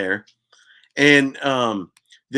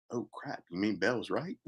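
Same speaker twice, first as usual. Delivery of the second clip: much softer, sudden hushed realization; hushed dismay; 3 semitones lower, 13 dB quieter than usual. Retold or imitated. The breakfast table realizes Bell was right, hushed dismay. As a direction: drop to a stunned near-murmur.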